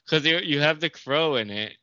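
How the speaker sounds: ordinary delivery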